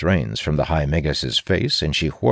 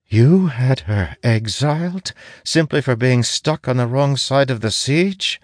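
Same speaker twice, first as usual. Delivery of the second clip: high, appalled disbelief; horrified; 9 semitones higher than usual. Acted. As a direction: recoil at what he did. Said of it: "The High Magus sounds horrified".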